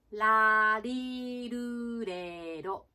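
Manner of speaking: ラリルレロ drawn out slowly with L, like Japanese ラ行; L sounds. Shown as a demonstration. Pronunciation coach showing learners L first, slow L-sound ラリルレロ. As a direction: stretch ラリルレロ, each syllable a clear L. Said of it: "Lの発音で、ラリルレロ言ってみますね".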